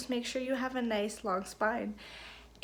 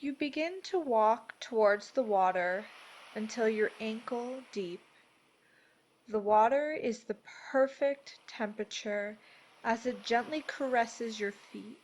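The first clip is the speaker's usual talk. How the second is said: measured, soothing delivery, each phrase given room; guided meditation; 4 dB quieter than usual. Acted. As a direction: guide them gently into peace, unhurried.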